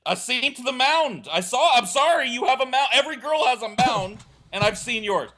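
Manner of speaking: insist, flustered and high; sheepish pleading that keeps climbing; high defensive insisting. Retold or imitated. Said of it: "And I'm like".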